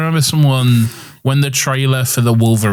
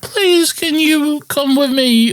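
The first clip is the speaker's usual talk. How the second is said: high, slow pleading mimicry; mocking his voice; much slower than usual. Mocking the friend's woman voice back at him. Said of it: "whatever stupid voice you're doing".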